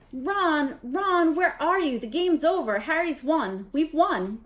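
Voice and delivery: high, loud and breathless with joy; excited shriek. A narrator's reading. Hermione shrieking to Ron across the stands, excited. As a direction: shriek it as the winning news. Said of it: "shrieked Hermione".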